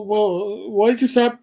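ordinary delivery

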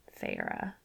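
low soft caressing 'Feyre'. A narrator's reading. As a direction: say her name like a caress. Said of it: "He said my name like a caress".

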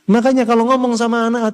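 normal talking voice

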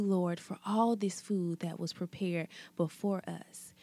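ordinary talk

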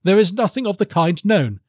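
high, indignant courtier; high-pitched professional umbrage; offended baron. Acted. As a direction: deny it in a high, put-on baron's voice. Baron denies it, reputation assailed.

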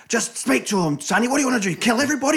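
high, loud acted pleading; mock movie argument at full pitch; heated argument. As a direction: raise the voice into an exasperated demand. Acting out Godfather war argument.

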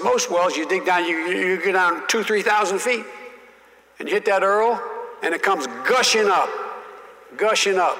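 lightly drawled Southern senator; imitating Russell Long. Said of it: "he said".

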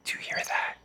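hushed, breathy whisper. Pretend. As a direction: whisper it like a secret. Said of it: "Why are you whispering?".

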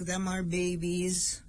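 usual speaking voice

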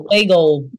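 "Bagel" with a Brooklyn vowel and a hard dark L. Shown as a demonstration. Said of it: "Hard L".

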